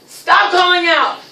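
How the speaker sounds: high, slow, emphatic command; mock telling-off. Shown as a demonstration. Teacher stops a pupil calling out, mock telling-off. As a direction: scold the pupil for show.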